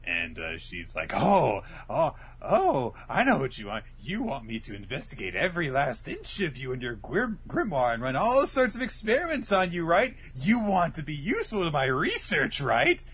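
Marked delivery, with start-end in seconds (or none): 1.1-13.0 raised-pitch, gleefully creepy researcher; creepy excited scientist. Retold or imitated. Scientist gloating over her captive test subject, creepy excitement. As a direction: gush like an obsessed mad scientist. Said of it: "establishing her creepy character traits".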